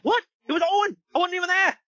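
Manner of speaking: high, indignant British impression; British accent. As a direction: protest innocence in a squeaky British voice. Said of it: "are you doing a British accent there?".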